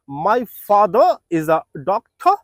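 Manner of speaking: My father is a doctor read very slowly; slow model sentence. Shown as a demonstration. Teacher dictating a model sentence slowly. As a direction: dictate it word by word. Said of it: "sentence make করতে পারেন".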